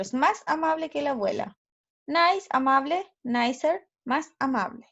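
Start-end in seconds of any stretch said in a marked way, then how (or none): none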